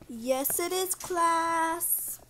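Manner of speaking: normal talking voice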